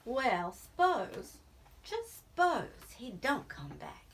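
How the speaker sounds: ordinary delivery, nothing marked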